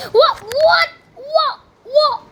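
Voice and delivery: high, loud British-sounding yelps; startled, high British exclamations; accidentally British. Real, not acted. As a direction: let a British accent slip out in shock. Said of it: "Why am I starting to sound, sound British?".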